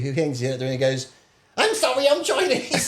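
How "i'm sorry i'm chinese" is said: put-on very high, silly Chinese-accent apology; mock Chinese accent. Imitating a mate excusing bad driving, mock Chinese accent. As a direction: squeal out a fake Chinese accent. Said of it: "but he makes that stupid".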